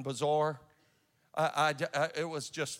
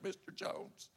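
scared retelling; 4 semitones higher, 10 dB quieter, slower than usual. Retold or imitated. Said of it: "I was scared to death".

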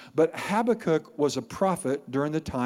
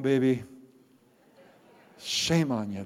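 ordinary delivery

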